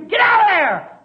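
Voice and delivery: high, loud urgent shout; screamed warning. Acted. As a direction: scream a warning to someone in danger. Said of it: "I'm screaming to you".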